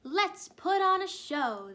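high, loud, drawn-out slow yell; excited group yell. A narrator's reading. Penguins pitching a show to the mayor, yelling. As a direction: shout the big idea out. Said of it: "they yelled".

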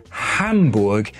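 careful German pronunciation of Hamburg; a Brit's best go at German; German accent. Shown as a demonstration. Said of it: "put on my very best German accent".

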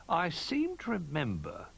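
high, hesitant recollection; slowly. A narrator's reading. Old Bear searching his memory, slowly. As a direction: take it slowly, thinking back. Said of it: "he said slowly".

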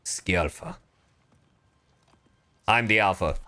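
normal talking voice

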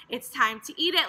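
usual speaking voice

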